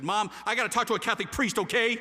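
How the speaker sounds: loud, high, exasperated burst; blurted confession. Retold or imitated. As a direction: act out forcing it out all at once. Blurts confession to his mother.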